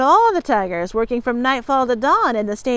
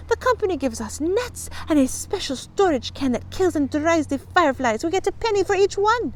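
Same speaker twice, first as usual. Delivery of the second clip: put-on high-pitched girl explaining; earnest girl client; 7 semitones higher than usual. A narrator's reading. Fanchon explains the firefly business, earnestly. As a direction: explain it in a high, young girl's voice.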